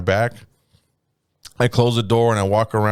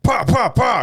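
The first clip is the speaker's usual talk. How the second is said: high gunshot noises, pa pa pa; imitated gunshots; 11 semitones higher, slower than usual. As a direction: mimic three shots fired from the hip. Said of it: "from the hip was like".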